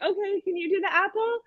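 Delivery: high, soft soothing offer; gentle coaxing. Retold or imitated. Teacher calming a boy mid meltdown, high soft coaxing. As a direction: coax softly as to an upset little one.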